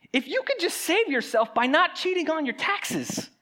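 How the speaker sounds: real passion building toward the cross; getting worked up. Real, not acted. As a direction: preach it with rising heat, voice going up. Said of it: "Now I'm getting all worked up".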